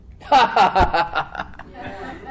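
high loud burst of laughter; Abraham's laugh at God's promise. Retold or imitated. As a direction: laugh like a man falling over in disbelief. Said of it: "He falls down laughing".

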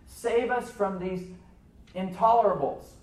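slow, high plea, loud on the last word; crying out. Retold or imitated. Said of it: "That's what they were crying out for".

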